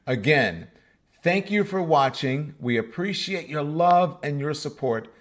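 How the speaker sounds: outro announcer read. Acted. Host reading the sign-off promo to viewers, bright announcer voice. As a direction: sell the membership like a closing ad.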